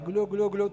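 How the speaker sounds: loud mimicked glugging of poured water; gorgoteo imitado. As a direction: glug it out like emptying a bottle. Imita el agua derramándose. (imitación fuerte de agua cayendo)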